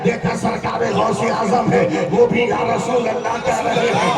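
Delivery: loud, driving sermon storytelling; full-force preaching. Acted. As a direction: preach the dialogue out to a big crowd. Preacher acts out angels questioning saints.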